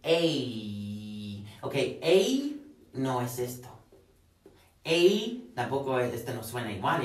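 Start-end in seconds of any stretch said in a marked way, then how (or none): 0.0-1.6 "A" held long as the vowel ending play; "A" drawn out on a lower pitch; ending sound isolated. Shown as a demonstration. Teacher isolating the sonido at the end of play, long low "A". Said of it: "el sonido con el que termina".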